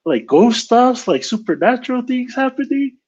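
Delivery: high-pitched, wondering inner voice; retold unease, pitch climbing; mock spooked. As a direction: act out a rising worry that it's ghosts. Retold spooked thoughts in his head.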